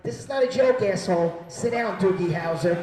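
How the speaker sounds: loud, irritated put-down; scolding woman. Retold or imitated. Woman on plane dismisses him without hesitation, loud scolding. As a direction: snap at him to back off.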